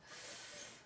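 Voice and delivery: "f" as a bare voiceless hiss; just breathe out "f" through teeth; letter sound F. Shown as a demonstration. Teacher showing the "f" sound, unvoiced hiss.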